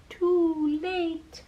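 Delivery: usual speaking voice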